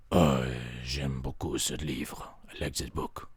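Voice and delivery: low, soft, slow aside; hammed-up deep reader; low bookish mutter. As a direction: pretend to read quietly. Hummer speaks low, slow.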